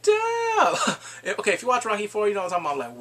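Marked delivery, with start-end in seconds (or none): 0.0-0.8 much higher, loud amazed yell; real excited outburst; shouted damn. Real, not acted. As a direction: shout it in disbelief. Reaction to grand intro.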